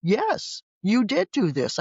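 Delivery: a retold mom's accusing jab; hint of an angry mother pointing a finger; imitating angry mom. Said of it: "Mom was mad".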